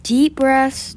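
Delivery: ordinary delivery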